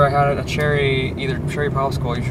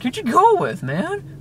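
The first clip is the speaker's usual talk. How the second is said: high mock-incredulous demand; hammed-up high-voiced friend; imitating freak-out; slower than usual. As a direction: imitate someone scandalised, pitched way up. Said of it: "he's freaking out".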